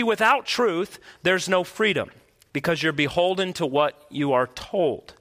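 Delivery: usual speaking voice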